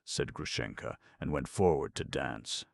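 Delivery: ordinary delivery, nothing marked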